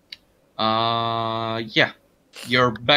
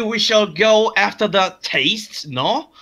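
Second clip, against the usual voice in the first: higher-pitched mock French accent, drawn out; 9 semitones higher, 4 dB louder, slower than usual. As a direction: put on a hammy French accent. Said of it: "let's go with French".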